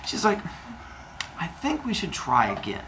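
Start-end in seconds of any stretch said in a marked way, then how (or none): 1.4-2.8 soft, careful proposal; tentative suggestion. Acted. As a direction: broach it gingerly to a husband. Mother Earth cautiously broaching more children.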